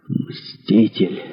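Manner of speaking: soft, hushed last word; barely voiced murmur; dying whisper. A narrator's reading. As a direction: breathe it out faintly. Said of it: "прошептав".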